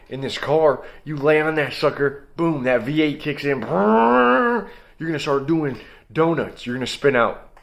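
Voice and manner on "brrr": high rolling lip-trill engine noise; engine rumble. Retold or imitated. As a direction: imitate a V8 kicking in. Imitating V8 engine kicking in.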